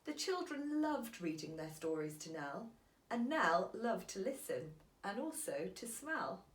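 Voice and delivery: ordinary delivery, nothing marked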